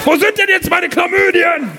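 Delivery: high, slow whiny demand; mock whine. Demanding his promised chlamydia, high slow mock whine. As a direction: whine like a cheated customer.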